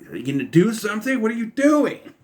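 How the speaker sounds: exasperated demand, rising high at the end; mock impatience. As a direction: press a stalling opponent for action. Acts out impatience at stalling fighter.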